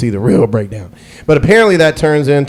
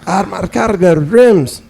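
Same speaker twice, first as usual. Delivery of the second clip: mock Armenian accent, slow and higher; imitating Armenian accent; 7 semitones higher, slower than usual. Imitating the Armenian gas station guy's accent, mock accented. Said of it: "he immediately went into an urban accent".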